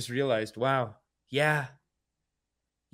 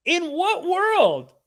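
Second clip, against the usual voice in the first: slow, higher, drawn-out disbelief; incredulous; 10 semitones higher, much slower than usual. Acted. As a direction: let the words hang in disbelief. Incredulous retort at guest.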